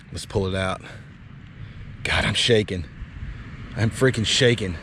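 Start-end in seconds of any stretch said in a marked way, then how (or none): none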